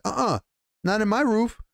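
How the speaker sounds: imitating a host putting his foot down; retold firm refusal. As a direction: cut them off with an indignant, high no. Retells lord's refusal to allow attack.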